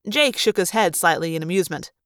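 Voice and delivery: plain reading voice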